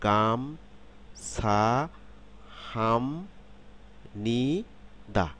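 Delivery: very slow "গাম-ছা-হাম-নি-দা", each syllable stretched; slow teaching breakdown of "গাম-ছা-হাম-নি-দা", with m in "হাম". Shown as a demonstration. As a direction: draw out every syllable of "গাম-ছা-হাম-নি-দা" with a pause after it. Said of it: "খেয়াল করুন".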